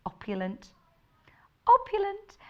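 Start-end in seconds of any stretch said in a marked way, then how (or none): 1.7-2.4 pitched up high, polished telephone manner; a lifted, very proper phone-greeting voice; posh phone voice. Shown as a demonstration. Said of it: "Especially with your phone voice".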